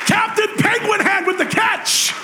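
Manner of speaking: high, slow shouted call; triumphant play-by-play shout; hyped announcer shout. Acted. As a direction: call it like a sportscaster. Announcer-style hype of catch.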